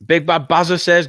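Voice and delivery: normal talking voice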